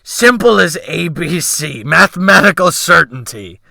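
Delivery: mocking repeat. A narrator's reading. Sailor mocks the young man's words, loud sneer. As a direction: throw his words back at him with scorn. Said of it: "he snorted".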